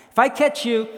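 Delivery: high, loud threatening yell; angry threat. Acted. Homeowner chasing intruders through the park, angry yell. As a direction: yell the threat after them. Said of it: "I was mad at this point".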